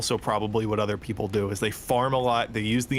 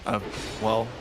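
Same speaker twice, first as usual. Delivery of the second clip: ordinary talk, nothing marked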